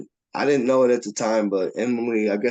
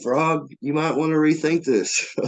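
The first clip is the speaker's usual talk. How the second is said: higher-pitched buddy's caution; imitated warning; 8 semitones higher, 3 dB louder than usual. Buddies urging Frog to rethink the fight, imitated warning. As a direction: talk a friend out of it.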